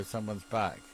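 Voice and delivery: normal talking voice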